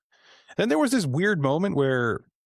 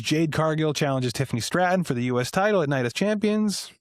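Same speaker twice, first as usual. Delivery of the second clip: ordinary talk, nothing marked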